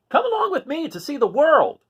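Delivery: rooster's voice. Acted. Rooster asking the cats to travel, high eager call. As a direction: chirp the invitation up high like a cocky rooster.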